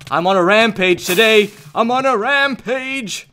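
loud, high triumphant yell; rowdy high battle boast; rampage boast. Pretend. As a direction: crow it loudly, stretching the last word. Boasts during game killing spree.

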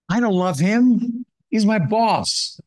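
a hint of a sulking son who will not yield; bitter older son. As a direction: let the grudge rise up high and slow. Preacher voices the bitter son.

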